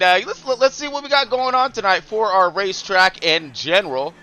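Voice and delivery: ordinary talk, nothing marked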